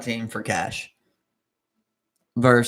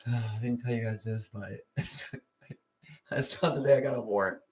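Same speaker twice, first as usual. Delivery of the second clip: hushed and much softer, sheepish; quiet confession; 4 semitones lower, 10 dB quieter than usual. Retold or imitated. Foley admits a warrant to friends, hushed and sheepish. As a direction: confess it low, half-laughing.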